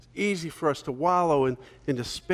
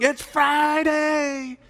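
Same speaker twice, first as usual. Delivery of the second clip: high, slow, drawn-out It's Friday!; mock preacher scream; 11 semitones higher, much slower than usual. The old pastor at his sermon's climax, hammed-up scream. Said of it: "he's screaming at the top of his lung".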